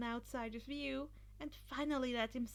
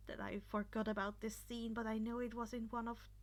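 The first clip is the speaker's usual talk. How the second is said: plain reading voice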